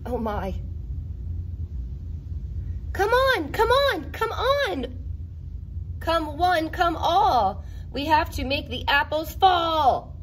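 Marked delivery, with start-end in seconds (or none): none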